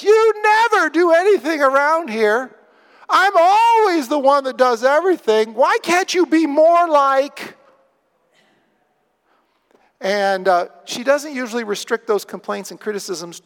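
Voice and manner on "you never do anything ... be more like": high, loud nagging mock tirade; hammed-up shrieky complaint, drawing out the accusations; complaining, criticizing wife. Preacher acting a wife criticizing her husband, loud high-pitched nagging. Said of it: "She complains and criticizes".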